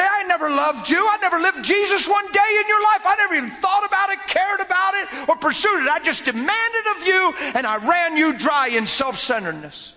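husband manning up. Retold or imitated. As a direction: pour out the apology, finally owning it.

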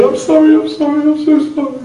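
high, loud mock-crying wail; tearful apology. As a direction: wail it repeatedly like someone crying hard. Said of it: "So she runs looking for me, saying".

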